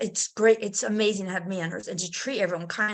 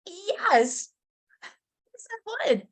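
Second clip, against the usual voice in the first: eager yes; 9 semitones higher, slower than usual. Retold or imitated. Eager acceptance.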